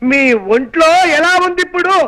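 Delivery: shouting. Acted. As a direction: call it out word by word so she hears. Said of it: "అంత గట్టిగా అరవకు నాయనా".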